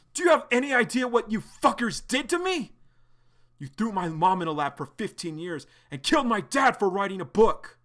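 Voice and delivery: John confronting Arlo over his parents, furious; furious scream. Acted. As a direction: hurl it at him in fury.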